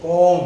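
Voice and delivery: কম said loud and clear as kɔm, the অ sounded after ক; কম spoken out with a full ক-অ; inherent vowel sounded. Shown as a demonstration. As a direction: stress the অ in কম for the class. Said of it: "অ এর উচ্চারণটা একটা আসছে".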